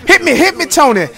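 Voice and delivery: high, eager shout for the pass; excited call for the ball. Real, not acted. Calling a teammate for the pass, high and eager.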